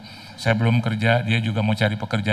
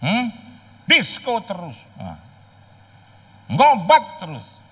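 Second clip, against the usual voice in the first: high, loud shouted scolding; mock angry scolding; 10 semitones higher, 4 dB louder than usual. Father yelling at his son, high mock scolding. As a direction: yell it like a furious father. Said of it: "Papanya bilang".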